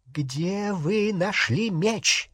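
drawn-out, dangerous demand; menacing interrogation. A narrator's reading. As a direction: press for the answer with threat. Bellatrix repeats her threatening question.

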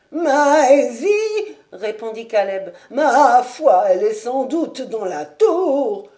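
put-on high, loud, slow quavering old servant; old servant pleading. Caleb pleads with his master, loud quavering old servant. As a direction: plead like a fussing old retainer.

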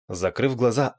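ordinary delivery, nothing marked

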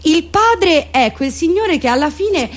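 ordinary talk

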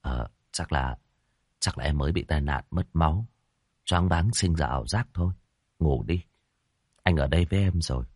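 quiet her down gently; soothing. Acted. Hoàng calms her to sleep.

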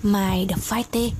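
plain reading voice

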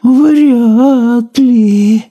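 very high sighing snail voice. A narrator's reading. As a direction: sigh it out as the gloomy snail. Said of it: "вздохнула улитка".

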